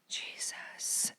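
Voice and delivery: breathed-out disgusted Jesus; whispered disgust. Real, not acted. Host, revolted by booger eaters, whispered. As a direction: exhale the word in revulsion.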